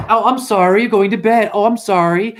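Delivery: high, loud mock apology; hammed-up condolences; sarcastic sympathy. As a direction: exaggerate the pity to ridicule it. Throws the pity back sarcastically.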